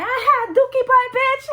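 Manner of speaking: high, loud jeering cackle-taunt; mocking schoolgirl taunt. Mocking how the girl claimed they taunted her, high jeer. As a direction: sneer it like a bathroom bully. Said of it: "That's how she was explaining it".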